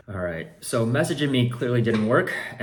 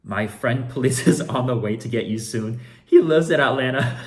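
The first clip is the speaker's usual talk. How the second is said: much louder, higher, laughing through the line; laughing while reading; 4 semitones higher, 9 dB louder than usual. Retold or imitated. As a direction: crack up reading the threat. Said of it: "reading buyer's threat, laughing".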